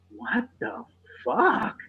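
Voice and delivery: high, slow, drawn-out incredulity; stunned disbelief. Retold or imitated. Dad retelling his reaction to being scratched, stunned. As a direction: stretch each word in mock disbelief.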